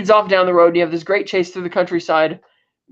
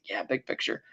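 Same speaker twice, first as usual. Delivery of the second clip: low, much softer mock concession; reluctant acknowledgment; 5 semitones lower, 14 dB quieter than usual. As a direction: grudgingly give in, almost under the breath. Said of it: "kind of reluctantly acknowledges it".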